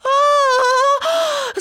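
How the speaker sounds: very high panicked shriek; long high squawking wail; parrot's scream. A narrator's reading. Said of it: "зарепетував папуга".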